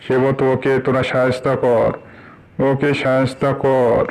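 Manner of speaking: loud, urgent plea for help; loud pleading call. Acted. (জোরে সাহায্যের আকুতি)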